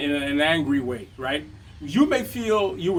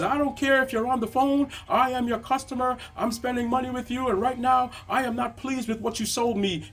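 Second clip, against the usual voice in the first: high-pitched mock indignant customer; hammed-up complaining, pitched up high; irate customer; 8 semitones higher than usual. Customer complaining to the shop owner on the phone, mock angry and high. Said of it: "He says".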